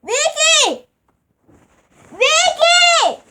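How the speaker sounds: high loud call across the house; calling the sister downstairs. Real, not acted. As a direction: yell her name to be heard.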